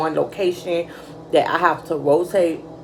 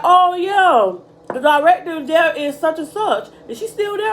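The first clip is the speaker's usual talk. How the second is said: chirpy interviewer; 10 semitones higher than usual. Retold or imitated. Quoting interviewer who knew her director.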